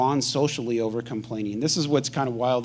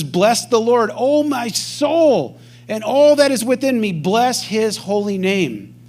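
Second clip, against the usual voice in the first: slow, raised, fervent reading; psalm recitation; 6 semitones higher, 4 dB louder, slower than usual. Acted. Preacher proclaims the psalm to the congregation, fervent and slow. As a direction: lift the verse up to God slowly. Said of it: "It says".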